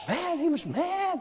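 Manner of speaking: high, soft squeak on MAD; incredulous high-pitched repeat; comic emphasis. Retold or imitated. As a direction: squeeze "mad" up high and small.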